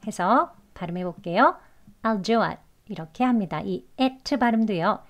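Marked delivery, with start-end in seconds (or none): 2.0-2.6 I'LL shrunk to a short "al" in "I'll do it"; casual reduced "I'll do it"; quick conversational form. Shown as a demonstration. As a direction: run "I'll do it" together quickly, "al-du-at". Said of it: "회화 발음에서 빨리 지나가는 발음으로".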